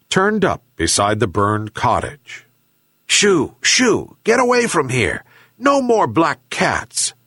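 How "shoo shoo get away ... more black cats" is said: high-pitched angry shooing. A narrator's reading. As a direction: chase it off crossly, voice pitched up. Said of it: "angrily said the old fisherman".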